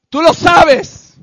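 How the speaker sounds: loud, slow, each word pressed hard; very slow shout. Real, not acted. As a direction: drive it home at full force. Preacher confronts the listener.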